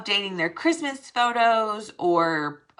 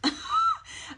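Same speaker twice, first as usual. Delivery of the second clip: high laugh. Real, not acted. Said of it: "laughs at her own joke".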